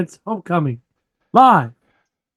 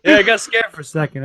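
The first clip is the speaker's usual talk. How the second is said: ordinary delivery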